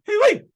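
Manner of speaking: very high, loud "hee-wee" squeal; playful high whoop. Pretend. Speaker cheering for his America, high mock-patriotic whoop.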